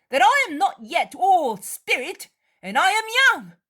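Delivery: high, loud defiant yell; rude angry shout. Acted. Judas shouting at Mary to leave him. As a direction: throw her off, shouting. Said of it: "says Judas rudely".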